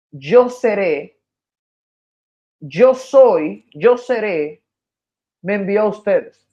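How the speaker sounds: spoken demonstration. Shown as a demonstration. Teacher showing how Moses would sound to the people, slow and deliberate. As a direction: declare it deliberately, as a model sentence. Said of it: "Sonaría de la siguiente manera".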